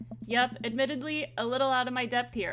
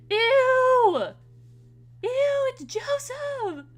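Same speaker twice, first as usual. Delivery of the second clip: high, loud squeal of disgust; disgusted shriek; 13 semitones higher, 8 dB louder, much slower than usual. Acted. Disgusted at seeing Joseph.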